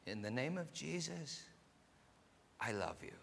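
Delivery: a missionary's soft, hushed I love you; whispered tenderly. Retold or imitated. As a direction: breathe it gently into frightened hearts. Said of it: "so they could whisper".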